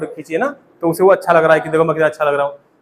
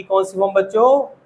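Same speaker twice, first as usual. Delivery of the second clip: normal talking voice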